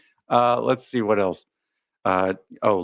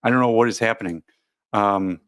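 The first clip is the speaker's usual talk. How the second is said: ordinary talk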